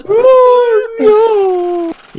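loud, high despairing yell; anguished shout. Real, not acted. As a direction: cry it out in dismay. Losing all the money.

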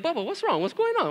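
high-pitched fretting questions; retold concern. Dad asking upset son what's wrong, retold concern. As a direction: imitate a parent anxiously checking on a child.

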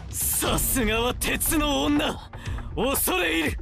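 high-pitched theatrical salute to a foe; villainous, drawn-out exclamation; mocking acclaim. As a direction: hail the opponent with grand scorn. Avenger mockingly hails opponent after laughing.

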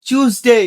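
"টিউসডে" as chooz-day; "টিউসডে" British style, starting like "choose"; British pronunciation. Shown as a demonstration. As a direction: say "টিউসডে" with an opening "ch". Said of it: "সঠিক উচ্চারণ British ইংলিশে".

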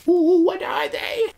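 very high, slow put-on voice; playful curious squeak. Vlogger teasing her own gift reveal, high playful squeak. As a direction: squeak it like a mock-curious onlooker.

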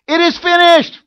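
high, loud ceremonial shout of the priest; priest's loud cry. Retold or imitated. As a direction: cry it out so it carries outside the temple. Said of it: "they would scream it loud".

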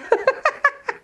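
high-pitched burst of laughter; self-mocking laugh. Real, not acted. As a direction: laugh at your own memory lapse. Laughs at her memory lapse. (éclat de rire aigu)

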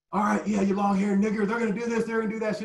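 high mocking heckle; taunting fan. Fan taunting a player before the game, high mocking heckle. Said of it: "we're just doing that shit talking back and forth".

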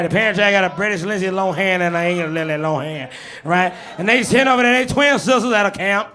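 Bernie Mac bit; Bernie Mac impression. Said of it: "show off my Bernie Mac impression".

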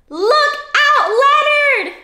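high, loud warning shout; calling out a warning. Pretend. Reader warning Leonard high up the tree, loud high call. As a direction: cry out to Leonard on the branch.

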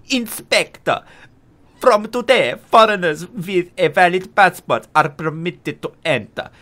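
put-on slow, deliberate German-accented letter reading. Player reading the ministry letter aloud, mock German accent. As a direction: read the official notice with a put-on German accent. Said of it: "I is becoming German again".

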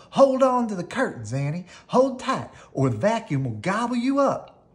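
high, loud urgent cry; urgent warning. Acted. Melissa yells to Annie to hold on, urgent. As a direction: shout a warning over the noise.

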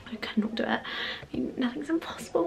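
soft whispered confession; hushed aside to the camera. Real, not acted. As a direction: breathe it out quietly. Hushed in a room of people.

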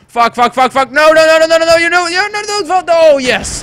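panicked yelling. Real, not acted. Gamer jumping off a cliff for a relic, panicked yelling. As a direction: yell in rising panic while falling.

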